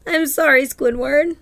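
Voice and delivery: high, eager cartoon sponge; chirpy apologetic cartoon voice; SpongeBob voice. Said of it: "And then he's like".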